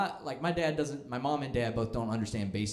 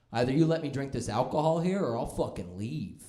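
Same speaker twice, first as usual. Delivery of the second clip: mock ultimatum, higher pitch; mad customer; 4 semitones higher, 4 dB louder than usual. Angry bar customer issuing an ultimatum to the bartender, mock mad. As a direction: imitate an indignant drunk customer. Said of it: "he got all mad".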